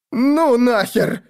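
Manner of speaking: high, slow frightened squeak; squeaky yelp. Acted. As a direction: squeak it out like a scared boy. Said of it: "пискнуло".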